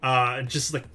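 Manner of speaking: ordinary talk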